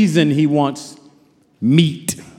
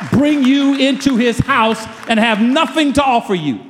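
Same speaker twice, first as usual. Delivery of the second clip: preaching; 4 semitones higher than usual. Acted. Preaching builds to full force.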